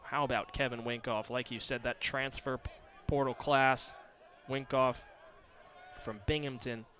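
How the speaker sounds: ordinary talk, nothing marked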